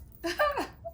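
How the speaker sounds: a squeaky amused laugh; high burst of laughter. Real, not acted. Laughs after the Karen quote.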